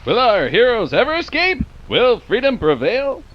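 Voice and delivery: put-on dramatic old-time cliffhanger; radio serial announcer. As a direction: build suspense like a radio serial.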